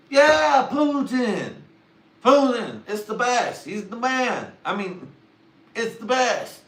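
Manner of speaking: mock fan shouting his praise; excited shout. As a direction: yell it like it's obvious.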